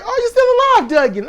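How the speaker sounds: high, loud mock surprise; Cedric greeting. Cedric greets Duggan backstage, mock surprised and loud. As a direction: squeal it like a startled old friend. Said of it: "Cedric was like".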